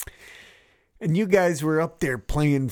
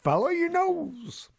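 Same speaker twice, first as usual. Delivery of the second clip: high, slow cartoon mascot voice; sing-song it like a cereal-box bird; Toucan Sam; 14 semitones higher, 3 dB quieter than usual. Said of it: "Just like Toucan Sam said".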